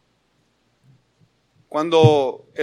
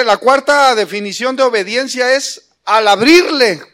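loud, higher, emphatic sermon delivery; full-force preaching; 5 semitones higher, 7 dB louder than usual. Acted. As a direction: preach it hard to the congregation.

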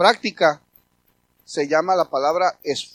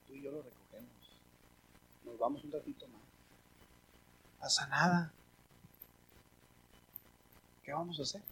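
gentle comfort; 5 semitones lower, 15 dB quieter than usual. Retold or imitated. Pastor comforting a weary church helper, very soft. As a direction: hush and soothe a tired friend. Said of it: "Pero es que pasan".

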